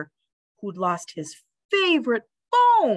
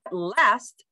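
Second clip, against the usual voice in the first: ordinary delivery, nothing marked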